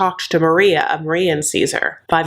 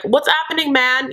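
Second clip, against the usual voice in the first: higher, breezy "What's happening, man?" as a bro; mock chummy greeting; 7 semitones higher than usual. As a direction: throw out "What's happening, man?" pitched up like a buddy. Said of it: "that was, like, more like St".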